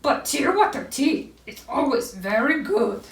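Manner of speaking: contented owl character voice; happy Owl. A narrator's reading. Owl enjoys his tea, happy character voice. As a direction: pronounce the verdict as a pleased owl. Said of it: "Owl felt happy".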